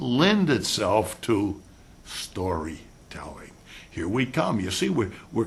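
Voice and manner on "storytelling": whispered. Real, not acted. Trailing emphasis word.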